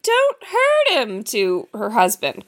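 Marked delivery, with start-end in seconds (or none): 0.0-1.2 high, slow begging plea; pleading wife. Retold or imitated. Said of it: "So she's just like".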